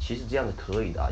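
normal talking voice

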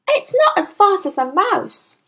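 put-on high-pitched, lively girl; high little-girl voice; eager child Matilda. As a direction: play a keen child sharing a fact.

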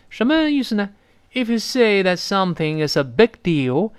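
normal talking voice

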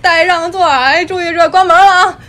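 loud, high, fast Beijing conductor slurring the words together; rapid Beijing conductor. Retold or imitated. Beijing subway conductor yelling at the passengers in a high, loud, slurred Beijing accent. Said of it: "列车员说话没这么清楚".

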